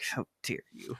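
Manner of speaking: hushed, offended whisper. Retold or imitated. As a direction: breathe it out, scandalized. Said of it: "And I'm just like".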